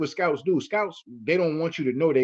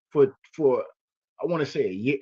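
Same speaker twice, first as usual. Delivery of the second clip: normal talking voice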